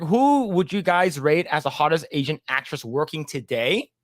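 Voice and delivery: ordinary talk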